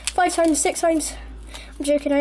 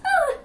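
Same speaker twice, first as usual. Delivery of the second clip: startled yelp; 13 semitones higher than usual. Real, not acted. Balloon startles him.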